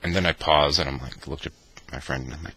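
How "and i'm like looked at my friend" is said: usual speaking voice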